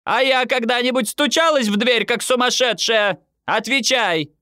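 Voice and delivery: high-pitched indignant demand; mother's outraged tirade; relentless scolding. A narrator's reading. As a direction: keep nagging, pitch held up high. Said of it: "мама, не дожидаясь ответа, продолжала".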